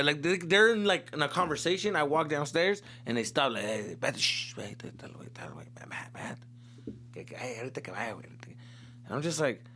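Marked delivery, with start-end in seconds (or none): 3.6-8.2 whispered, secretive hush; hushed family whisper. Retold or imitated. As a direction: hush each other as he walks in. Family hushing when he enters.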